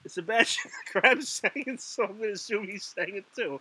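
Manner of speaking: laughter breaking into the words; fighting back a giggle fit; laughing it out, then trailing off quietly; giggling. Real, not acted. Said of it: "I don't know why I'm laughing".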